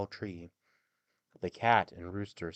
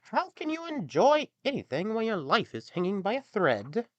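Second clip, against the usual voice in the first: much higher, louder sulky cat; cat complaining to the donkey; gloomy cat; 12 semitones higher, 7 dB louder than usual. Acted. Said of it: "the cat replied".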